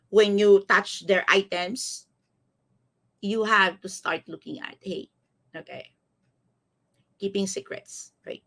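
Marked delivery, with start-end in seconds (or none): none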